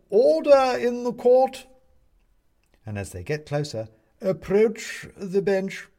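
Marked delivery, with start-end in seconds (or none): 0.1-1.7 high, drawn-out courtroom shout; calling out. A narrator's reading. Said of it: "he calls out". 4.2-5.9 high, loud, drawn-out summons; pompous owl judge. Acted.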